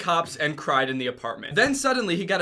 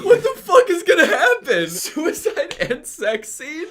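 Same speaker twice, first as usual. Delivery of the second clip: laughing disbelief; 11 semitones higher, 7 dB louder, slower than usual. Real, not acted.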